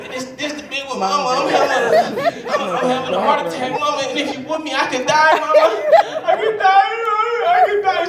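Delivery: high, pleading mock-dying child voice; whiny pleading child. Son begging his mama, hammed-up high whine. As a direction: beg Mama like a kid faking a heart attack.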